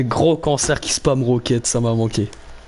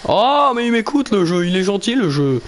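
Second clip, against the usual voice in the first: delighted; 7 semitones higher than usual. Real, not acted. Delighted game listens.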